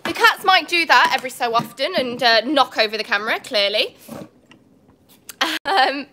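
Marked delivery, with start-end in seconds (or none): none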